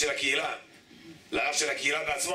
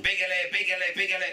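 imitated hawker, higher and repetitive; higher street-seller chant; straining vendor's cry; 4 semitones higher, faster than usual. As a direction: call out your wares over and over. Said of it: "מוציא את הגרון".

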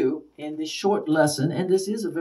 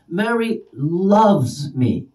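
LOVES drawn out and louder, slow line; stress on LOVES; 5 semitones higher, 4 dB louder, much slower than usual. Shown as a demonstration. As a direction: lean long and hard on "loves". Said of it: "Same words, different nuances".

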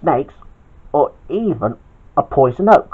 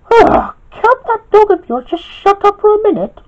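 much higher, fed-up bossy lady voice; annoyed boss Queenie; 5 dB louder than usual. Acted. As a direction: sound irritated by the barking dog. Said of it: "asked Queenie".

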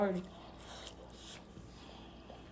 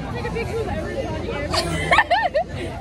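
high laugh burst; 12 semitones higher than usual. Real, not acted.

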